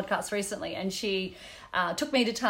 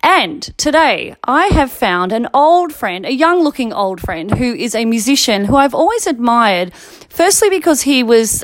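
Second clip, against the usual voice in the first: podcast intro; 14 dB louder than usual. Acted. Host opening the podcast episode.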